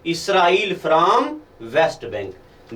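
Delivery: very slow, phrase by phrase for note-taking; dictation. Shown as a demonstration. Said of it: "तो आप लिख दो".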